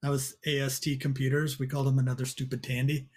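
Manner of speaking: ordinary talk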